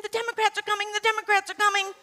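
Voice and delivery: high, hurried mock alarm cry; high, fast panicky mock cry; Chicken Little panic. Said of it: "like Chicken Little saying".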